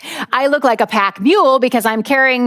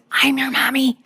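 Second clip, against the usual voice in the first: whispered urgently under the breath; 3 semitones higher, 4 dB quieter than usual. Retold or imitated. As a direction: hiss it so no one else hears. Worried aside about social services.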